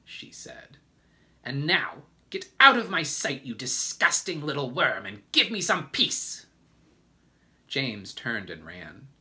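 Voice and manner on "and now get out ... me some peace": high, loud, scornful scolding; furious dismissal. A narrator's reading. Aunt Spiker sending James out of sight, furiously. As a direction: drive the child off with a shouted insult.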